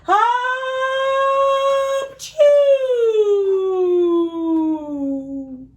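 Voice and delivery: a loud, high, hammed-up bear sneeze; big sneeze. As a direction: blast out a cartoon sneeze for the kids. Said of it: "makes the bear go".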